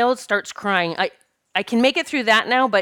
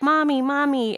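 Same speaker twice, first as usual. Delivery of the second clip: lightly voiced little girl calling out, higher; a hint of a child calling for mom; 6 semitones higher than usual. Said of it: "was a little girl".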